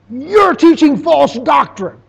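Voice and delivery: high, loud accusing yell; ranting preacher shout. Retold or imitated. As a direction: thunder it at him from the pulpit. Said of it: "ranted and raved and waved his arms".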